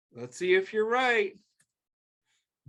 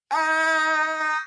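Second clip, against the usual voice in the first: high, loud playful yell; 9 semitones higher, 6 dB louder than usual. Pretend. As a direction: yell out big for the kids. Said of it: "let's do it together".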